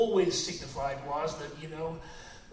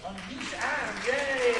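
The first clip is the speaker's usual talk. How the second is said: raised, gleeful cheer; 6 semitones higher, 5 dB louder, much slower than usual. Real, not acted. Film-maker praises his singer to the crowd, cheering. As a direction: build to a loud, higher hurrah.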